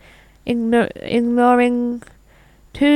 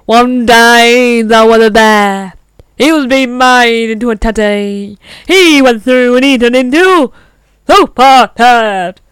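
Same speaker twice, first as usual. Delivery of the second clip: storybook narrator voice; 6 dB louder than usual. Retold or imitated. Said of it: "it's like".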